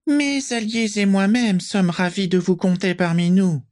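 a sweet-voiced villain, high and sibilant; soft high hissing voice. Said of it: "de sa voix doucereuse, aiguë et sifflante".

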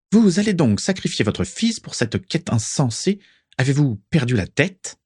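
high, indignant scolding; high-pitched squawk. A narrator's reading. Prisca questioning the mad quest, high-pitched squawk. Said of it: "sa voix haut perchée".

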